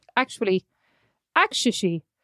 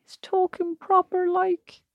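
mock posh voice; 10 semitones higher, 3 dB quieter, much slower than usual. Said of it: "I'm like".

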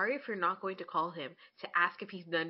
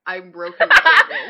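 loud, hammy dramatic reading through a laugh; mock-read it like a tragic headline; 17 dB louder than usual. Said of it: "that's my dramatic reading voice".

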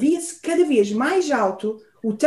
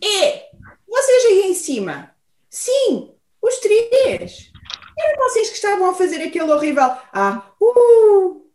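moose calling up; 6 semitones higher, 3 dB louder than usual. Acted. Moose calls up the tree.

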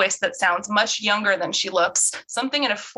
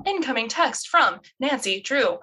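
stilted, higher virtual assistant voice; phone AI assistant; 4 semitones higher than usual. Acted. Virtual assistant announcing a text to Bess, stilted and synthetic.

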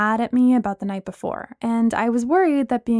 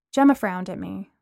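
ordinary delivery

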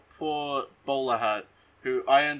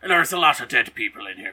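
loud, hammed-up character voice; Mister Krabs; 12 dB louder than usual. Lawman arriving to find the lost family, hammed-up character voice. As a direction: put on a big cartoonish announcing voice. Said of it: "Clancy Brown shows up and he's like".

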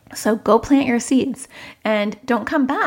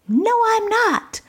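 put-on much higher, slow, bratty child barking back; defiant child; 14 semitones higher, slower than usual. Child barking back at parent, high and bratty. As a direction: whine it back like a defiant kid. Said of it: "your child is going to bark back".

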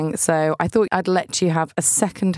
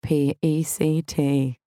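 spelled-out reading; slower than usual. Pretend. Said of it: "standing up in a church and going".

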